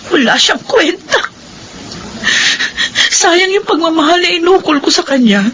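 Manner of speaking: tearful bitterness. Acted. Wife bitter about husband.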